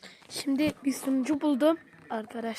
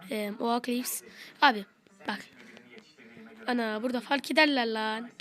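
normal talking voice

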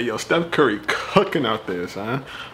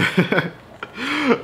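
laughing; 14 semitones higher than usual. Real, not acted. Laughing at his own joke.

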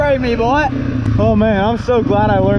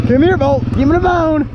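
high-pitched call to the dog; 8 semitones higher than usual. Real, not acted. Calling the dog from a distance.

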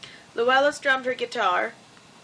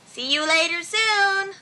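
high, drawn-out singsong farewell; cheery goodbye; 7 semitones higher than usual. A narrator's reading. Luella parting from Norton after the evening, cheery goodbye. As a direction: call it out slowly, pitched up.

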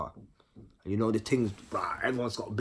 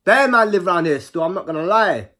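loud exclaim; 7 semitones higher, 10 dB louder than usual. Retold or imitated. Retold reaction to granddad's area.

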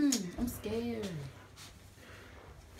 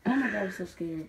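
ordinary talk

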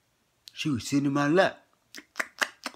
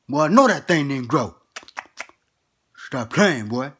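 louder scolding grandpa; 2 semitones higher, 7 dB louder than usual. Acted.

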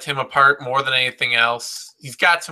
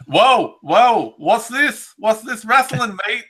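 high, hammed-up Australian accent; mock an outraged Australian fight fan; a mocking Aussie "whoa, mate"; Australian bloke; 9 semitones higher, 3 dB louder than usual. Mocking Australian fans' reaction.

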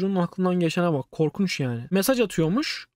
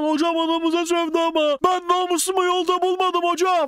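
high, indignant protest yell; student yelling his honor was insulted; indignant shout; 7 semitones higher than usual. Retold or imitated. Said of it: "falan diye bağırıyor".

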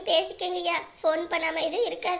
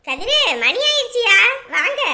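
calling out; 2 semitones higher, 11 dB louder than usual. Acted. Mother calls her son from outside, loud call. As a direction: shout his name so he hears from outside.

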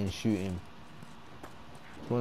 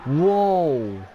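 surprised whoa; 5 semitones higher, 6 dB louder than usual. Real, not acted. Startled in game.

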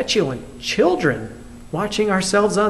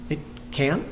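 doubtful echo; 4 semitones lower, 9 dB quieter than usual. Retold or imitated. Incredulous reply to a quote.

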